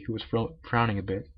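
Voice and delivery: usual speaking voice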